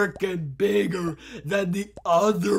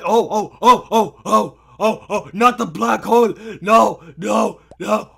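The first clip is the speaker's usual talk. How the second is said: louder, rapid panicked cries; 7 dB louder than usual. Real, not acted. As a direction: panic as danger closes in.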